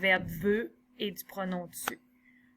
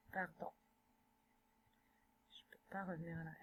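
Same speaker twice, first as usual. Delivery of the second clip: under her breath, to herself; whispered aside; 5 semitones lower, 21 dB quieter, much faster than usual. Real, not acted. Teacher stuck on the screen, whispering to herself. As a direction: whisper it, annoyed, not meant for the class.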